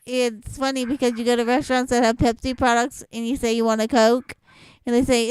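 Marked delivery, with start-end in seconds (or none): none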